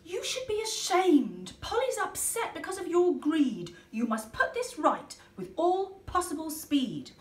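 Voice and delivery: a hint of a scolding swan; lightly stern swan. Swan scolding the ducks, mildly stern. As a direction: tell the ducks off gently. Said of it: "said to the ducks".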